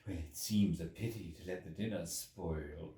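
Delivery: a hint of a practical, matter-of-fact editor. A narrator's reading.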